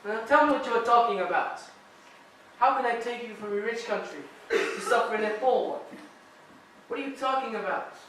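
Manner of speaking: usual speaking voice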